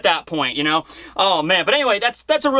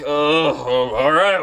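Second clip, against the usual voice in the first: low, slow mock villain sigh; Kaido; 5 semitones lower, much slower than usual. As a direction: drag it out low and unimpressed. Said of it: "And then Kaido's like".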